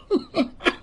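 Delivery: soft chuckle; short, quiet laugh. Real, not acted. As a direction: chuckle under your breath. Laughs after mock scolding.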